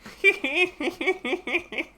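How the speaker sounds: sudden bright burst of laughter; quick happy laugh; high laugh. Real, not acted. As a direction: laugh out loud at your own joke. Laughs at teapot joke.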